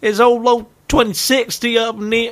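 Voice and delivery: put-on high old-timer voice with a Texas twang; hammed-up Texan grandad; Texan grandfather. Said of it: "you gonna be living in Texas".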